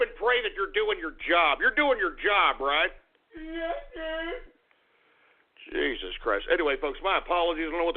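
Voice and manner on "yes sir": high, thin, timid yes sir from a different voice; squeaky engineer. Acted. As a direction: answer the boss meekly in a tiny high voice. Engineer answers, far cry voice.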